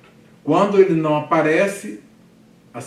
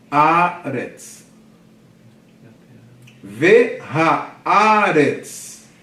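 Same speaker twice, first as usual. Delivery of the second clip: very slow, loud, with long stretched vowels; syllable by syllable; 3 semitones higher, 6 dB louder, much slower than usual. Shown as a demonstration. Teacher showing four syllables in Ve haaretz, slowly drawn out. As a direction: pull Ve haaretz apart into separate long syllables. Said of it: "Quatro sílabas".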